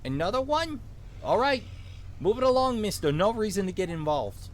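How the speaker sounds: high, urgent Minnesotan cop giving orders; vaguely Minnesotan accent. Acted. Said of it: "give him a vaguely Minnesotan accent".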